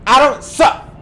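high, loud indignant protest. Real, not acted. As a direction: snap back that you don't suck. Defending himself after game taunt.

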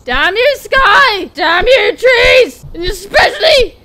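loud, rising hammed-up wail; melodramatic curse. Lost bear cursing sky, trees and Harold in mock anguish. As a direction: shake your fist at the heavens, mock-tragic.

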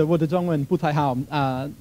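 normal talking voice

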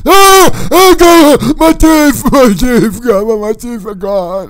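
loud, high panicked wail; panicked screaming. Acted. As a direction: scream in horror, easing into a wail. Discovers his teeth are gone.

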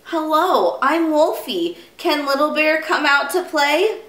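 high, innocent little wolf voice; friendly little wolf. Acted. Wolfie asks Father Bear for a playmate, high and friendly. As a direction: ask high and politely, like a small child.

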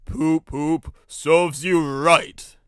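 loud, drawn-out tooting taunt; taunting engine. A narrator's reading. Gordon jeering at Henry stuck in the tunnel, loud slow taunt. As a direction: toot it loudly, stretching out the jeer. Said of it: "Others, like Gordon, would say".

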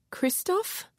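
high, hushed wondering; timid question. Acted. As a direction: ask as if from far away. Dazed woman recognizes name.

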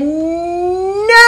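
put-on high, drawn-out kid's no; a child who won't budge; stubborn child's no. Thomas refuses teacher again.

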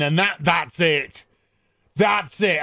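ordinary delivery

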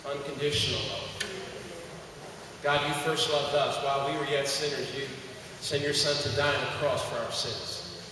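soft, unhurried prayer voice. Acted. Pastor praying over the congregation, calm prayer. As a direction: pray over the room gently.

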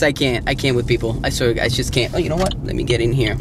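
ordinary talk, nothing marked

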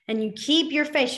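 normal talking voice

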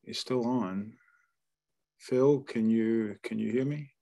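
ordinary talk

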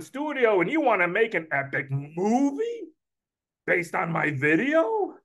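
put-on incredulous Brooklyn accent, questions swooping high; Uncle Murray. Uncle hearing a studio executive's offer, astonished Brooklyn accent. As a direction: repeat it back in rising Brooklyn disbelief. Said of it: "Me, I give him a Brooklyn accent".

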